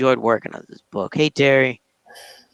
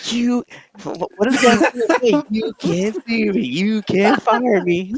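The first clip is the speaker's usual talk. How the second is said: laughing banter; 8 semitones higher, slower than usual. Pretend. Playful banter about firing.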